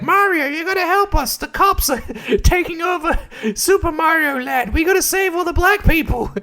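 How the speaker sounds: very high squeaky cartoon plea, breaking into laughs; Toadstool. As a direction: plead in a tiny high voice. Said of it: "Toadstool comes up and he's".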